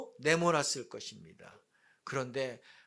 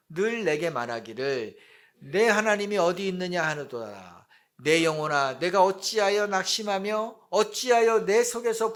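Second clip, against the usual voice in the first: scripture reading; 5 dB louder than usual. Acted. Preacher leading the congregation through a psalm, loud solemn reading. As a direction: read it out loudly for all to follow.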